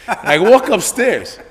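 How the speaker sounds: normal talking voice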